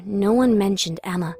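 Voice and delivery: ordinary delivery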